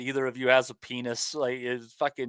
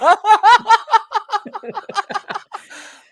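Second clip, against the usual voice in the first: high, loud burst of laughter; howling laugh; 12 dB louder than usual. Real, not acted. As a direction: cracks up completely, shrieking. Explodes laughing at Bosch joke.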